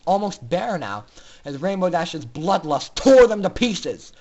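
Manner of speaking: ordinary delivery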